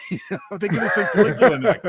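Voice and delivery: laughing through a silly pitch; cracking up while floating a joke idea. Real, not acted. Co-host pitching a joke sequel, laughing. Joking about a Caligula episode.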